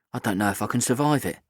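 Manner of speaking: ordinary delivery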